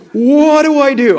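high, loud, slow panicked cry of what do I do; panicked new dad. Retold or imitated. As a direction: blurt it out like a dad suddenly left holding his newborn. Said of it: "And I was like".

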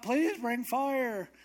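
high, slow imploring wail; crying out. Retold or imitated. Prophets crying out to their god, high and pleading. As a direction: mock-desperate plea, drawn out. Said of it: "they're crying out".

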